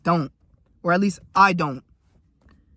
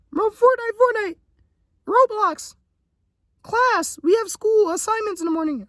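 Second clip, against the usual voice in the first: mocking friends. Friends mocking his dreams with Fortnite, squeaky high mock voice. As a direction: whine it like a clueless gamer friend. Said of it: "And I'd be like".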